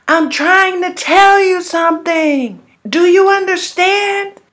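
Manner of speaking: high, loud, slow, like a voice shouting through a far-off radio; distant yelling. Retold or imitated. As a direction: strain to be heard across the distance. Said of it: "And he was yelling".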